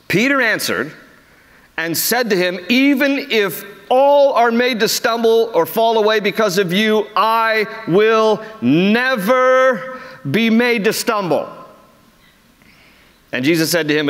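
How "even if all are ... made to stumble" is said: bold boast. Retold or imitated.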